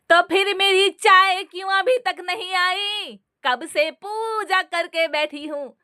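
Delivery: put-on high, slowing complaint of an old mother-in-law; demanding mother-in-law. A narrator's reading. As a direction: nag her about the late tea. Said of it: "सास बोली".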